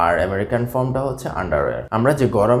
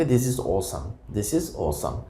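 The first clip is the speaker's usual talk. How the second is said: slow, softer demo of "This is awesome"; American phrasing demo; 2 semitones lower, 6 dB quieter, much slower than usual. Shown as a demonstration. Teacher shows learners the American way to praise, slow and soft. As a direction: model the American "This is awesome" slowly and quietly. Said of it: "আমেরিকানরা বলে থাকে".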